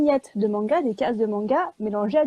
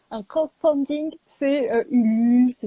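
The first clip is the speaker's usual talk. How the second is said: put-on English accent; 4 dB louder than usual. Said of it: "je m'entraîne".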